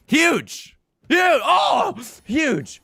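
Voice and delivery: high, loud triumphant yelling; excited shouts. Real, not acted. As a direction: yell it like you just won big. Calling out a huge win.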